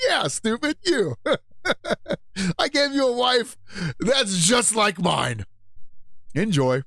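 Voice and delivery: God's voice. Retold or imitated. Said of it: "And he's like".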